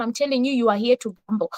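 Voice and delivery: normal talking voice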